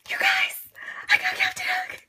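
very high, loud squeal; excited squeal. Real, not acted. Fan squealing to viewers about getting Captain Hook. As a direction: shriek with joy.

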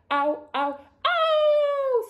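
very high, loud yelp of pain; screaming. A narrator's reading. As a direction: build to a long, shrieking ow. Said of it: "screamed the wolf".

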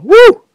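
high, loud falsetto woo; excited whoop. Real, not acted. Gamer starting Ball Fall, excited high whoop. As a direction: let out a quick high whoop.

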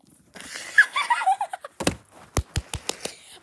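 long airy high laugh, giddy; breathless giggle. Real, not acted. Vlogger showing her book, long airy giggle.

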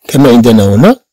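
ordinary talk, nothing marked

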